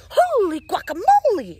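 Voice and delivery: loud high startled cry; exclamation. Acted. Kitten startled by his own mirror, loud and high. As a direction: blurt it out in shock. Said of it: "exclaimed Skippy John Jones".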